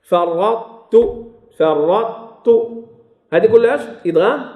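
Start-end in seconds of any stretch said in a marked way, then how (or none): 0.0-2.5 فرطت with a heavy held ṭ opening into a light t; incomplete merging. Shown as a demonstration. Teacher models فرطت, heaviness of ṭ kept before t. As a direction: hold the ط of فرطت unreleased, then say tu. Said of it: "ناقص".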